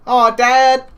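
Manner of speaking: put-on high whiny child protest; kid voice. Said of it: "I tried a kid voice".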